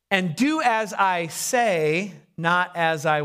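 drawn-out, deliberate instruction; slow, pointed. Acted. Elder excusing himself to younger person, slow and pointed. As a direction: stretch out each word and leave it hanging.

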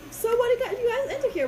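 high-pitched teacher greeting newcomers; imitated drama teacher. Voicing the drama teacher's question.

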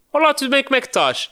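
high, loud cheerful hello; chirpy greeting. Retold or imitated. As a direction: toss out a bright, loud casual hi. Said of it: "fez aquela pergunta de". (uma saudação aguda e alegre)

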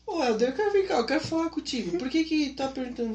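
high, anxious confronting voice; a worried young man demanding answers; worried missionary. Retold or imitated. Missionary confronting his secretary, high and anxious.

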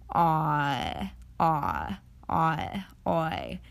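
Ah, ah, ah, oi drawn out slowly, one after another; slow vowel demo. Shown as a demonstration. Teacher shows the vowels slowly, drawn out. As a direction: glide Ah into oi slowly. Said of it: "Try saying both of these slowly".

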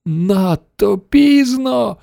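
very slow, high despairing cry; exclaiming. A narrator's reading. Wonka realising they missed the moment, slow high cry. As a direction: cry out high, stretching every word. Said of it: "вигукнув містер Вонка".

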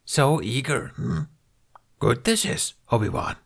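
high, croaky little Jedi master; Yoda voice. A narrator's reading. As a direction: speak as a wise old Yoda. Yoda speaking in the story.

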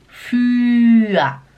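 "für" loud, rounded "ü"; für com Ü. Shown as a demonstration. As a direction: punch the round vowel in "für". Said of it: "Fala Ü". ("für" alto, com ü arredondado)

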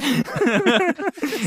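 a sudden high laugh breaks out; high burst of laughter. Real, not acted. Laughing at his own tangent, high burst of laughter. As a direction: let the laugh jump up high.

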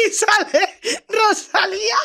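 cracking up while naming her; words squeezed out through laughter; laughing. Real, not acted. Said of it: "riendo mientras cuenta". (contándolo entre carcajadas)

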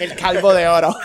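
laughing. Real, not acted. Joking about bald guys.

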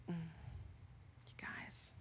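breathy hushed whisper to the room; soft confiding whisper. Real, not acted. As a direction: lean in and whisper it. Aside to the congregation.

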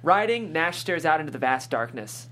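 ordinary talk, nothing marked

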